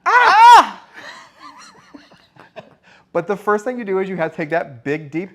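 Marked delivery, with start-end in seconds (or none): 0.0-0.8 sudden high loud cry; newborn scream. Pretend. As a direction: let out a baby's first scream. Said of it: "And scream".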